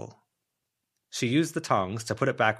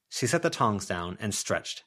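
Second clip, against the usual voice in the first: usual speaking voice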